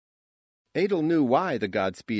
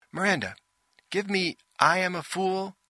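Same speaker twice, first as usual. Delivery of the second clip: high, theatrical directing voice; director's command; 9 semitones higher, 3 dB louder than usual. A narrator's reading. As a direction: cue the actress in a high, commanding voice. Director calls for the line.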